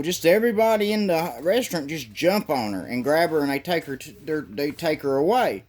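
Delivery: ordinary talk, nothing marked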